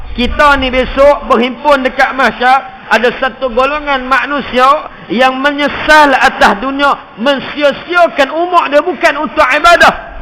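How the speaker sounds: high, loud sermon delivery; impassioned preaching; fiery preaching. Acted. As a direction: drive the warning home. Preaching at full force.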